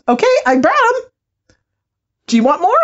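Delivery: high-pitched imitation of an overhelpful spirit; eager spirit voice. Spirit sister delivering a crowd of ancestors, high eager mock voice. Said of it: "and so this voice goes".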